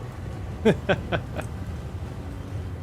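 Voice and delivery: unhinged villain laugh. Acted. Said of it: "the Madman voice pack".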